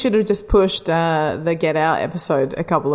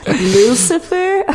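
dramatic spooky voice; 9 semitones higher, 4 dB louder, much slower than usual. Pretend. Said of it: "I was like".

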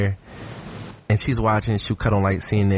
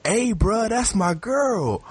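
high, slow mock warning; a dude guarding his girl, drawn out; jealous boyfriend; 11 semitones higher, slower than usual. Boyfriend warns the twerking friend, slow high mock protest. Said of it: "the dude was like".

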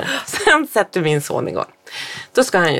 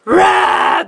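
mock roar; 4 dB louder than usual. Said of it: "för han skriker ju".

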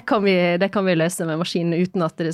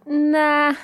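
higher-pitched, drawn-out imitated "nah"; retold dismissive reaction; 7 semitones higher, 3 dB louder than usual. Retelling a reaction to ChatGPT's poor Nynorsk, dismissive. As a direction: act out turning it down on a long high note. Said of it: "Så jeg bare sånn".

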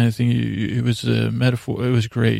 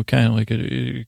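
ordinary talk, nothing marked

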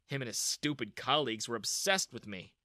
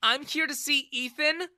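high, cutting retort; angry reply; 12 semitones higher, 6 dB louder than usual. Acted. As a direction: bite back at her father. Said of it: "she replied angrily".

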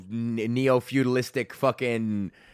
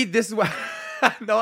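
usual speaking voice

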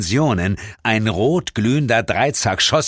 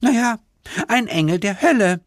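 put-on high, cheeky girl voice; a little devil girl, pleased with herself; hell angel voice; 13 semitones higher than usual. (freche Höllenengel-Stimme)

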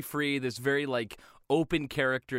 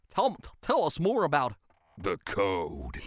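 Goofy. Retold or imitated. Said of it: "And Goofy's like".

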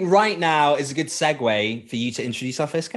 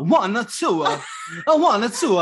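fitness instructor count; 10 semitones higher than usual. Retold or imitated. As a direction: shout the count to the class. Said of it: "instructor voices".

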